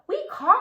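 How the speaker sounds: put-on baby crows answer together; little crows caw. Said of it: "said the six".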